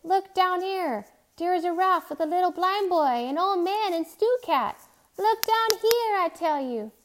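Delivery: high, loud desperate shout to a plane. A narrator's reading. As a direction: plead at full voice for rescue. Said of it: "Timothy shouted".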